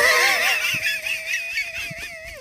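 high shout of laughter; shrieking laugh. Real, not acted. Bursts out at Latein mistake.

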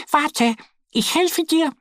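hohe kleine Entenstimme, viel höher und langsam gerufen; rufend. A narrator's reading. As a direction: wie eine kleine Ente hilfsbereit rufen. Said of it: "ruft Annie". (kleine Ente ruft dem Bären hoch und langsam zu)

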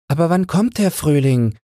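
high, eager bird question; sparrows asking. A narrator's reading. The sparrows asking the old one, high and eager. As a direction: ask it like a flock of small birds. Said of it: "fragten die anderen".

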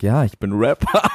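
low, smug rapper voice; cool rapper pose. Retold or imitated. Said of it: "streicht ihm so durchs Gesicht und sagt".

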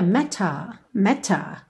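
matter with a crisp British T; matter, the T clearly pronounced; British accent. Shown as a demonstration. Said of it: "Num sotaque mais britânico".

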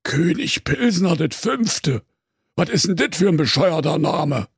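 high, incredulous Berlin cop; high-pitched astonished question. A narrator's reading. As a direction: echo the name in mocking disbelief. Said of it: "fragte Martina Semmelrocker erstaunt".

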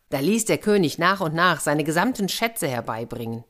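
ordinary delivery, nothing marked

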